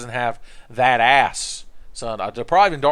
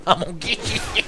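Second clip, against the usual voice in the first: higher, quicker, breaking into giggles; giggling playful threat; 8 semitones higher, faster than usual. Pretend. Player stalking a game enemy, giggling playful threat. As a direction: tease it, dissolving into laughter.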